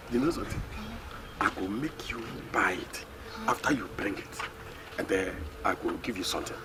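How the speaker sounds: very low and soft, secretive; confidential murmur. Acted. Man promising a reward for an errand, confidential murmur. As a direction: keep it hushed and deep.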